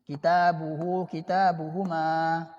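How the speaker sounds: "Kitabuhu, kitabuhuma" recited slowly and evenly; slow drill. Shown as a demonstration. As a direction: chant the "kitabuhu" forms slowly for the class. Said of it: "Silakan lihat". (ucapkan "kitabuhu, kitabuhuma" perlahan seperti latihan)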